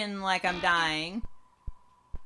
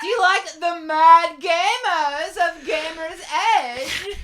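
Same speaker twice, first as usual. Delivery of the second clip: laughing; 11 semitones higher, 12 dB louder, slower than usual. Real, not acted. Laughing through a joke question.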